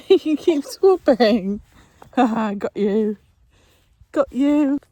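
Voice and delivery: loud laughing playful. Real, not acted. Mother laughing playing with the baby. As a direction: laugh through it.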